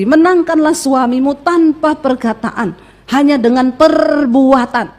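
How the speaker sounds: slow, drawn-out emphatic recitation; solemn verse quotation. Acted. Preacher quotes a verse to wives, slow and emphatic. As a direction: stretch out the key words.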